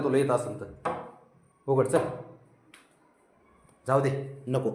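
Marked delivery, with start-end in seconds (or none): none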